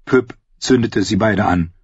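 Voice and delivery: plain reading voice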